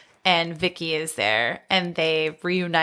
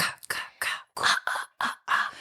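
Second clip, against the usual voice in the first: soft whispered "ka ka ka, ah ah ah" in rhythm; whispered killer sound effect; 5 dB quieter than usual. Retold or imitated. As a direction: breathe out the slasher-film chant in a whisper. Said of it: "There's that, like".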